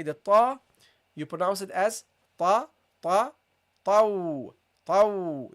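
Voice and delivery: ta and tau said heavy and emphatic; teacher drills the letter ta. Shown as a demonstration. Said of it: "This is also a heavy".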